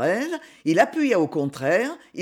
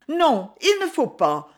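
higher-pitched exclamation of refusal; exclaimed protest; 6 semitones higher, 3 dB louder than usual. A narrator's reading. Young woman pulling back from his kiss, exclaimed protest. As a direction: cry out the refusal, pitch jumping up. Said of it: "s'écria la jeune fille".

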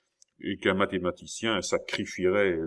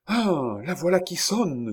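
much higher, delighted cry; eager exclamation; 9 semitones higher than usual. Acted. Young prince hearing his clock chime, excited cry. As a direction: call out happily at the chime. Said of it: "s'écria le jeune prince".

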